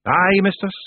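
proud little Feegle, high voice. A narrator's reading. Rob answering the witch, proud. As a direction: own up to it proudly, pitched high. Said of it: "said Rob proudly".

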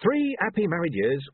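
mildly upbeat character voice; cheerful. A narrator's reading. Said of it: "said Arthur cheerfully".

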